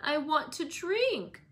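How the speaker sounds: high, slow whining demand; fretful exclaim. A narrator's reading. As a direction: whine it like a spoilt invalid. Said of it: "he exclaimed fretfully".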